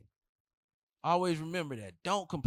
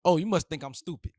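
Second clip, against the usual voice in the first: mad line; 2 semitones higher, 6 dB louder than usual. Retold or imitated. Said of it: "my favorite line is".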